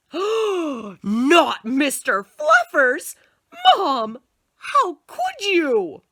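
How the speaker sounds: high, slow, loud wail of dismay; horrified child. Acted. Boy to mother over his bunny, loud slow horrified child. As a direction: gasp it out like a kid betrayed.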